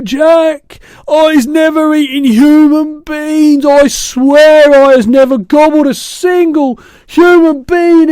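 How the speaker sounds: high, loud, wailing protest; screeched denial. A narrator's reading. Giant swears innocence to Jack, frantic screech. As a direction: screech your innocence in terror. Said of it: "he screeched".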